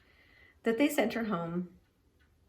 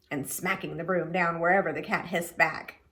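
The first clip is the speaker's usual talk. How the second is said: usual speaking voice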